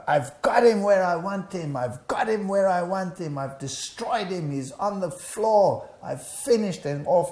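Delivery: hammed-up triumphant shriek, retold; retold demonic scream. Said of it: "she screamed at me at the top of her voice".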